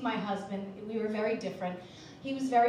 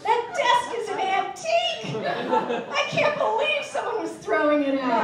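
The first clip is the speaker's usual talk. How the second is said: put-on drunk delight; 10 semitones higher, 5 dB louder than usual. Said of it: "and go".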